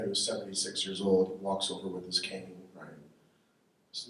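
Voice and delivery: ordinary talk, nothing marked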